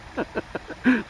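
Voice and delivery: high, breathy giggle; excited laugh. Real, not acted. Vlogger laughs at own hyped face, giggly. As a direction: burst into a short, high laugh.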